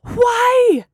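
loud, high, drawn-out wail; furious cry. A narrator's reading. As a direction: cry it out in fury. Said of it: "Gemma cried furiously".

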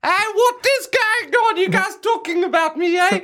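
high, loud nagging mum. Pretend. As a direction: scold him like a fussy mum. [name] plays Stuart's mum.

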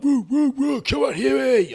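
high, loud whooping chant; thuggish cheering. Retold or imitated. Retelling the table egging on their boxing mate, high rowdy mock cheer. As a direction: whoop and yell like a rowdy supporter, imitated. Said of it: "Like, really sort of bit thuggish".

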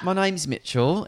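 slow, high put-on British voice; British accent. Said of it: "let me put on a British accent".

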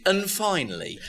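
high announcer sign-off; mock news anchor closing; newsreader voice. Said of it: "the light-hearted story at the end of the news".

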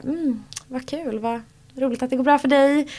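mock half-hearted congratulations; lukewarm praise. Imitating lukewarm townspeople met in the street, dryly unimpressed. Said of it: "vissa är lite så här".

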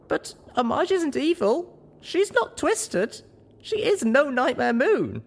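high, slow, emphatic protest; pleading pony heroine, every word pressed; insistent. A narrator's reading. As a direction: defend her earnestly in a high voice. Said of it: "I insisted".

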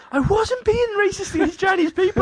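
very high, squealed retold protest; indignant protest. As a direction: defend yourself in a shrieky high pitch. Protests accusation of racism.

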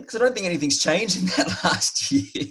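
amused, cracking up towards the end; breaking into laughter. Real, not acted. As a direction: let a real laugh take over the sentence. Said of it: "Sorry, I shouldn't laugh".

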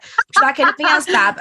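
lively mock Birmingham accent; Brum accent. Greggs worker asking a confused new student, in Brum accent. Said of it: "proper, proper Brum accent".

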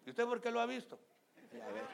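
high, loud laughing jab; playful laughing question; teasing laugh. Real, not acted. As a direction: tease the congregation with a laugh.

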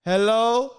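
a hint of an old Jamaican woman calling out; old woman Hello. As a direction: call Hello like a suspicious old lady. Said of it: "sound of an old woman saying".

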